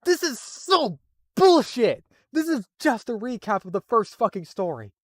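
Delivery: high furious slow shout; angry rant. Real, not acted. Fed up with the rehashed story, a furious shout. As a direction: yell it at the lazy recap. Ranting at the recap.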